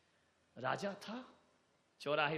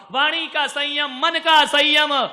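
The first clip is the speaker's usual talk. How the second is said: higher, much louder proclamation; preaching at full force; 9 semitones higher, 17 dB louder than usual. Acted. Preacher to the congregation, fervent loud proclamation. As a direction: proclaim each word as a commandment. Preacher climaxes his exhortation.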